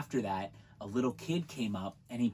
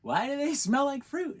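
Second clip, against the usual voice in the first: baffled; 13 semitones higher than usual. Retold or imitated. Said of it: "I was like".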